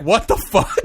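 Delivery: loud confused outburst; baffled. Retold or imitated. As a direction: blurt it out in disbelief. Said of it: "And Nick's like".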